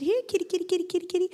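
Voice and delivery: high, coaxing kitty call; high sing-song "here, kitty"; calling a kitten. A narrator's reading. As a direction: coax a kitten over in a high voice. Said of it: "and he called the kitty".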